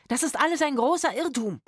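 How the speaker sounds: high, urgent protest; alarmed shouted plea. A narrator's reading. Trapped actor pleading with the armed strangers, alarmed shout. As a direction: call it out in alarm.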